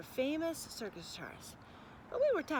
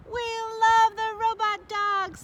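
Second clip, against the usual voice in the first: much higher, louder, slow cheering; children yelling; 12 semitones higher, 13 dB louder, slower than usual. Acted. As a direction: yell like a crowd of kids. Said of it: "they yelled".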